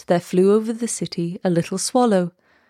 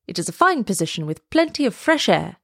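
ordinary delivery

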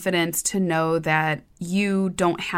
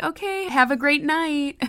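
sweet fake cheer; 9 semitones higher than usual. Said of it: "I'd be like".

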